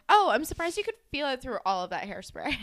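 put-on bitchy retort; catty. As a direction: toss off a mock-catty jab. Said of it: "like, so catty".

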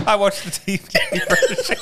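laughing. Real, not acted. Laughing at the joke.

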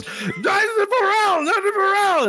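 very high, drawn-out goblin screech; goblin shouting. Acted. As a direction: squeal it out like an excited goblin. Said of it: "he turns to Chunks and he goes".

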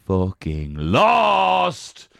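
loud, long-stretched bellow of defeat; drawn-out shout. Real, not acted. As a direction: stretch "lost" into a long yell. Announces own defeat in mock agony.

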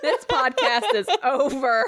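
high laughing outrage. Real, not acted. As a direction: laugh through mock disbelief. Reacting to mothballs joke.

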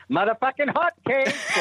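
put-on thick Boston accent, laughing. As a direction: go full Boston on it. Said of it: "do the voice because I come from Boston".

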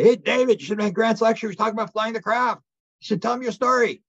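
high, eager shout-over to a friend; calling out. Retold or imitated. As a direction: imitate friends hailing someone across the room. Voicing restaurant group calling David over.

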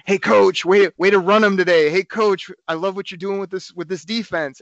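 loud, high fan heckle from the bleachers; cheeky fan shouting praise; yelling at the coach. Retold or imitated. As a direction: call out to the coach across the field. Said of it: "which is yell at people".

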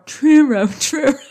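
churro said carefully as choo-roh; drilling churro like a language app; practiced churro. Shown as a demonstration. Drilling his practiced churro in the car. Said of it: "I had Google Speech on".

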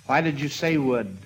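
ordinary delivery

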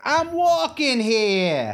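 slow, drawn-out mock New York accent; hammed-up slow New York accent. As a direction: stretch it like a tough New York cabbie. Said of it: "Where'd that accent come from?".

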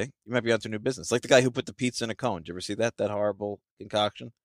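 ordinary talk, nothing marked

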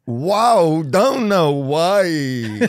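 very slow laughing Walken; Walken copy. Shown as a demonstration. Host copying Walken's line, very slow and laughing. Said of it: "copying Walken".

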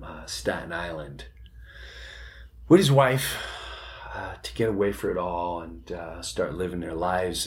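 low and soft, a sad confidence; lightly voiced somber woman breaking bad news; quiet, understated grief; hushed somber explanation. [name] quietly explains tragic backstory.

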